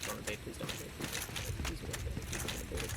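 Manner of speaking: anxious whispered plea; whispering. Real, not acted. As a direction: whisper it, hoping nobody invades. Nervous about being invaded online.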